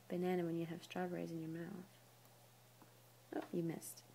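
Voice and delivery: soft unvoiced aside; whisper. Real, not acted. Parent whispering to her baby, soft whisper. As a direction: breathe it under the voice.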